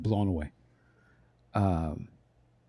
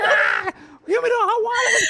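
pained cry. Retold or imitated. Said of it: "I was like".

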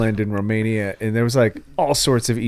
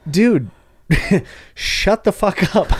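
slow, high, fed-up mock scolding; half-laughing, tell the influencer to stop; mock exasperation, pitched high; exasperated dismissal; 7 semitones higher, 4 dB louder, slower than usual. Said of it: "And it's like".

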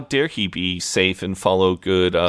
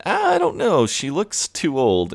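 mock-reluctant, pitched way up at the start; doubtful [name]; 9 semitones higher than usual. [name] doubting the old woman.

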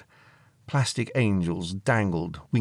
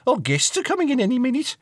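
high, loud and fast; shrieky mum warning her daughter; harried mum; 4 dB louder, faster than usual. Acted. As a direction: rush it out as a flustered mother.

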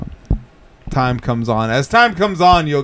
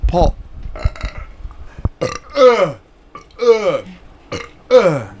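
burping; talking through a burp; burping the words; 4 semitones higher, much slower than usual. Real, not acted. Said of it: "I fucking burp like that".

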